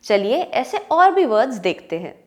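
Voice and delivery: usual speaking voice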